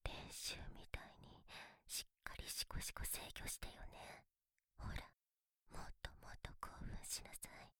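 soft teasing whisper. Acted. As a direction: whisper it close to the ear. Teasing whispers close to the mic.

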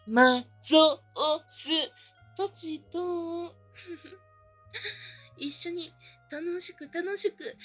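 ordinary talk

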